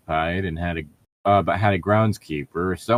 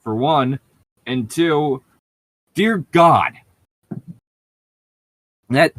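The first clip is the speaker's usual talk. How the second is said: rising higher and louder to a pained dear God; exasperated; 7 semitones higher, 5 dB louder, slower than usual. Real, not acted. Co-host despairing at the fanfic's writing, exasperated build. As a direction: build from grumble to an anguished plea.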